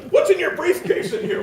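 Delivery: very high-pitched mock hectoring; belligerent prying. Psychic pushing host around over briefcase, high belligerent mock demand. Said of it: "he gets really belligerent".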